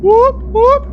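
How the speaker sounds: high whooping siren noise. Pretend. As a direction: imitate a police siren, high. Mock police siren.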